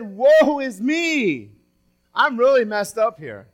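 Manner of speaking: big preacher's exclamation, drawn out on the woe; dramatic lament. Acted. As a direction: cry out in acted dismay. Preacher acts out Isaiah's cry.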